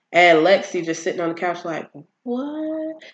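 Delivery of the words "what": high-pitched stunned squeak; mock shock. Vlogger imitating Lexi watching from the couch, mock stunned.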